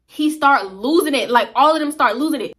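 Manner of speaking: normal talking voice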